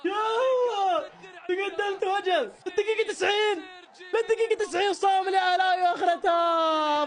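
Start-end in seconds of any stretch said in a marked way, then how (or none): 0.1-5.5 anguished cry. Real, not acted. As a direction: cry out at the last-minute loss. Team loses in the last minute.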